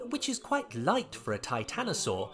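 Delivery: usual speaking voice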